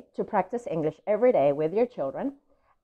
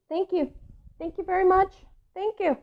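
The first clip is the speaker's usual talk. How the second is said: much higher, gushing thank-yous; excited thanks; 11 semitones higher than usual. Acted. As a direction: gush with delight. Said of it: "He's so excited".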